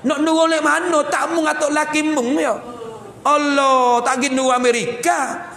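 high-pitched, exasperated comeback; mock scolding retort. Parent retorting about a troublesome child, mock exasperation. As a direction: throw it back as a hammed-up scolding.

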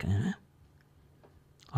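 whispered Aussie sign-off; soft whispered "eh"; whispered aside. Acted. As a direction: breathe it out softly. Ocker signing off.

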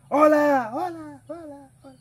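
high, loud call fading like an echo; calling into a cave. Retold or imitated. A gangoso calling into a cave, loud call. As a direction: shout hello into a cave. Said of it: "le gritaba a una cueva".

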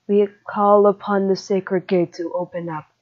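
ordinary talk, nothing marked

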